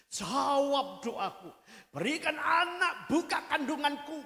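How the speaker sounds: loud, desperate plea, slowing on the demands; pleading cry. Acted. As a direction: beg God at full voice, stretching the last pleas. Hannah's prayer re-enacted.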